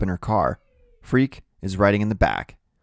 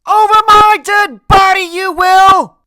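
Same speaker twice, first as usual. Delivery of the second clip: full-out, very high shout; furious grandmother's scream; tearful yelling; 15 dB louder, slower than usual. A narrator's reading. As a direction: yell it big and full of tears. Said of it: "voice is big and full of tears".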